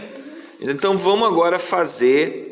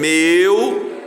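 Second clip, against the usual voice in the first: MEU held long as one piece; isolated syllable; 3 semitones higher, 4 dB louder than usual. Shown as a demonstration. Teacher marking the second piece of Romeu, drawn-out syllable. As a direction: stretch MEU in a single gliding syllable. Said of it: "segundo pedaço".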